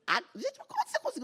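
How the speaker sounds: high incredulous voice; mock astonished socialite; squeaky disbelief; amazed colleague.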